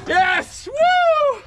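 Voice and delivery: high, loud whoop of triumph; real joyful cheering. Real, not acted. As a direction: let out a victory whoop. Celebrating the catch.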